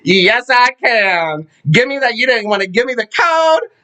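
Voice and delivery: high, defiant, drawn-out comeback; hammed-up defiant exit speech; sassy mom telling off. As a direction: throw it back at her. Mother tells off the nurse.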